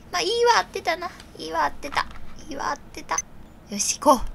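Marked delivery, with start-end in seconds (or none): 1.4-3.2 whispered to herself. Real, not acted. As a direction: hush it as a private relief. Whispered self-affirmation. (ささやき声で)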